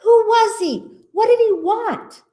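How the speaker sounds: high-pitched bewildered questions; high, acted alarm; crying out. A narrator's reading. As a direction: fire off puzzled animal questions, high. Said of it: "the animals cried".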